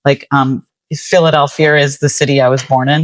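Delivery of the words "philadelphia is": an R linking "Philadelphia" to "is"; intrusive R. Shown as a demonstration. Poet demonstrating her accent quirk, intrusive R after "Philadelphia". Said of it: "there's something called an intrusive R".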